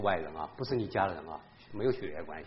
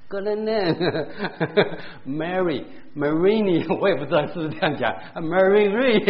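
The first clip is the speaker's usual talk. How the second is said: laughing; 7 semitones higher, 7 dB louder than usual. Real, not acted. Laughing at own nicknames.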